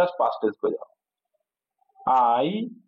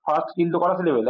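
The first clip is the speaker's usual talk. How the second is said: ordinary talk, nothing marked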